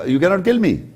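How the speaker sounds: ordinary delivery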